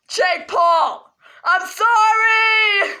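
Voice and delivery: slow, wailing fake-sob sorry; dragging the sorry out in a hammed-up cry; mock crying. Kid apologising to Jake Paul, slow mock sob.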